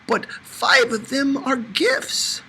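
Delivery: high, slow, shrill-voiced protest from Dika; shrieked protest. A narrator's reading. Dika shrieks her defense to Mom. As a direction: shriek it out like an indignant aunt. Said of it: "she shrieked".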